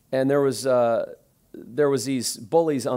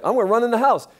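panicked plan; 8 semitones higher, 6 dB louder, faster than usual. Retold or imitated. Mike panicking, running home.

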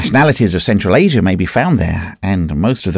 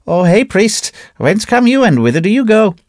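calling out to the priest; 11 semitones higher than usual. A narrator's reading. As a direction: hail the priest mockingly. Trader calls out to priest.

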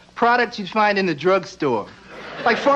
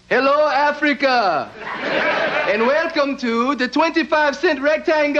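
mock game show host; 2 semitones higher than usual. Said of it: "some guy coming on T V going".

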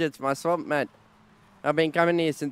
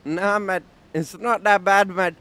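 usual speaking voice

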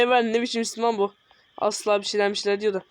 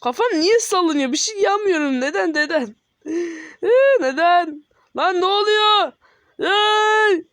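panicked; 7 semitones higher, 3 dB louder, slower than usual. Real, not acted. Panicking as the screen shakes.

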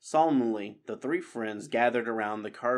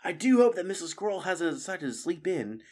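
put-on higher young mouse voice, light worry; Matthias hoping; 8 semitones higher, 3 dB louder than usual. Matthias worrying about Mrs Squirrel to friends, lightly voiced. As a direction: wonder aloud hopefully. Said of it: "said Matthias".

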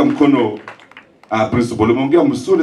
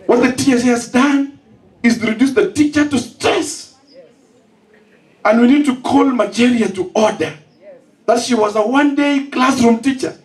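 high, impassioned rally speech; preaching at full force; 10 semitones higher than usual. Real, not acted. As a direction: thunder it at the crowd like a preacher. Fiery speech on teacher transfers.

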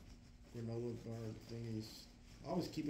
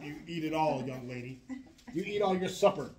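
loud, higher mock-stern parent; mock parent scolding; 5 semitones higher, 9 dB louder than usual. Man playfully scolds woman to eat up like a parent. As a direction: scold her like a mother at dinner.

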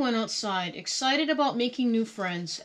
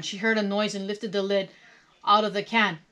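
ordinary delivery

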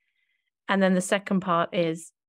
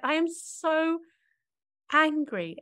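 much higher, light retelling of naming anger; high mock "so angry"; 9 semitones higher, slower than usual. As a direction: say it up high, half-joking. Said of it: "I remember just saying".

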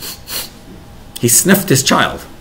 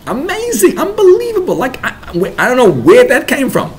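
gushing, much higher and louder, amazed; over-the-top excitement; 3 dB louder than usual. Shown as a demonstration. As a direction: rave about your kid as if it's a miracle. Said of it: "Talk about it with more excitement".

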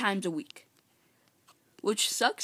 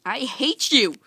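loud, higher burst of frustration; exasperated yell; 5 semitones higher, 8 dB louder, slower than usual. Real, not acted. Player to mobs attacking him, frustrated yell. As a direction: lash out at the game, louder and pitched up.

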